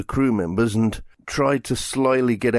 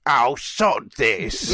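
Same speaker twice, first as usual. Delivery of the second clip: slow, dropped-act grumble; lightly voiced villain giving up the pretence; sudden fed-up Meep; much slower than usual. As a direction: drop the sweet act and drag it out. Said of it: "but goes from being".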